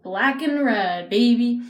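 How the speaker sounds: drawn out and punchy; hyped. Real, not acted. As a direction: sell it like a hype moment. Hyped about the makeup colours.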